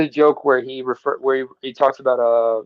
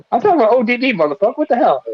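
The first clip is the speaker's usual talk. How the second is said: indignant outburst; 8 semitones higher, faster than usual. Real, not acted. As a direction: protest like you're offended.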